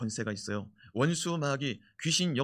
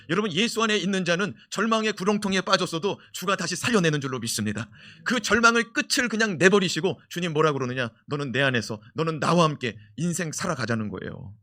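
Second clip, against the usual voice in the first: fiery preaching; 3 semitones higher, 5 dB louder than usual. Acted. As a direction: drive it home to the church. Preaching at full force. (열정적인 설교 톤)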